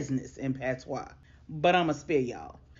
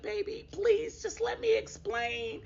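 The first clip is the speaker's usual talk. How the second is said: higher, slow, weepy mock plea; crying plea; slower than usual. Crying girlfriend begging her man to hear her out, tearful plea. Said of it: "Destiny was crying".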